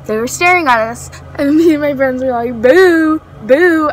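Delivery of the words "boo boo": retold jeer. Jeering at staring strangers.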